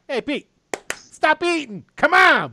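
impatient call to a companion. Acted. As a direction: yell over to get Pete moving. Calling out to Pete.

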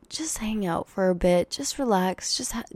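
whispered, soothing; hushed coaxing whisper; soft whisper. Acted. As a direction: breathe it out softly to calm down. Soothing herself to relax.

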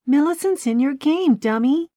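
high, loud, stressing game; exasperated. A narrator's reading. Gina explains Millicent to Keith, exasperated. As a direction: drive the point home, annoyed.

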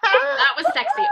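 high, laughing delight. Real, not acted. Reacting to the movie's mirror dance, laughing delight. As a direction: laugh it out, tickled.

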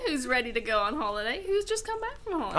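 lightly acted DJ hyping listeners; a hint of radio-host patter; airport radio DJ. Co-host pretending to welcome holidaymakers, lightly voiced DJ. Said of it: "a radio D J for an airport".

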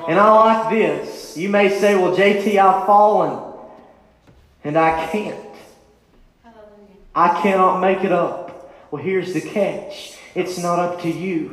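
a pleading sermon climax; full-force preaching. Acted. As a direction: drive it home to the congregation. Preaching to the congregation.

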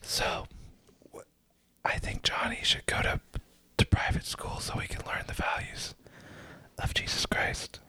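soft close whisper; whispered asmr role play. Pretend. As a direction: whisper as if a child might hear. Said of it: "we're just gonna whisper".

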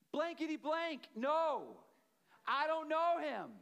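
angry denial. Retold or imitated. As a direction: snap it out as Peter, cornered and angry. Peter's angry third denial.

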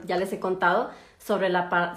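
ordinary talk, nothing marked

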